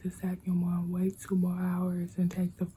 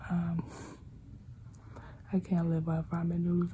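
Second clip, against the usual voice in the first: ordinary talk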